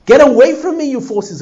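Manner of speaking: high, loud, forceful rebuke; fierce cry. Acted. Teacher acting the psalmist banishing evil forces, shouting. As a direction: drive the evil away with a shout.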